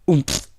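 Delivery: put-on a little boy's surprised oomph as he sinks; oomph grunt. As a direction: land with a bumped grunt. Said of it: "he said".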